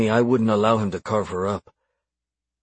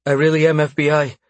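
higher, louder, urgent protest; rushed plea; 6 semitones higher, 5 dB louder than usual. A narrator's reading. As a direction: insist urgently to save yourself. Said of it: "she said in a rush".

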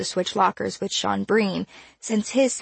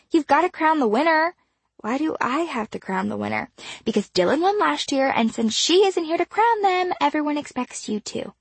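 lightly voiced teen girl; 7 semitones higher than usual. A narrator's reading. Star voice continues.

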